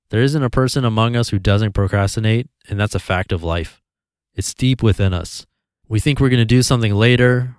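ordinary talk

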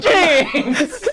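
hammed-up Italian accent, high. Friend joking with a cartoon Italian accent. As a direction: call out James like a mock Italian. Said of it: "the Italian accent".

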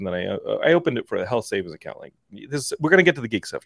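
usual speaking voice

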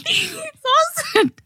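high, slow, laughing; laughing squeal. Real, not acted. Laughing mid-story.

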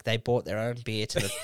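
usual speaking voice

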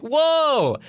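high, drawn-out startled whoa; taken aback. Retold or imitated. Speaker reacting to a suggestive picture, taken aback.

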